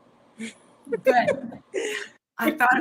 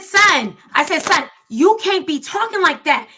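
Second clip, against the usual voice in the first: louder and higher scolding; scolding mom; 4 semitones higher, 8 dB louder than usual. Retold or imitated. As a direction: raise your voice at your son.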